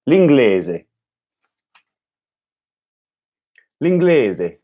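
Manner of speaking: L'inglese with a pure eh; repeating Italian. Shown as a demonstration. Learner repeating after the tape, careful Italian L'inglese. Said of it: "sound exactly like them".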